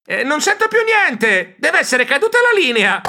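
high fake line-dropped excuse; lying. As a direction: fake a bad connection. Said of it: "mentì".

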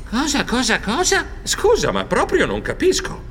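high stammered confusion; high squeaky baffled stammer; bewildered. Acted. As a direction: repeat it rising, baffled. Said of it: "lo fissai sconcertato".

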